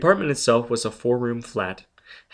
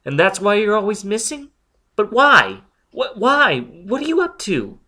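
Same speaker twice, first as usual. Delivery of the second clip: high, loud rapid demands; pressing him for answers; agitated interrogation; urgent questioning; 11 semitones higher, 7 dB louder than usual. Acted. Robin presses Foyle urgently.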